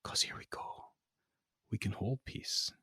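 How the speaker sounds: hushed mock-dramatic reading; dramatic whisper. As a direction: drop to a theatrical whisper. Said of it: "do a dramatic reading of the lyrics".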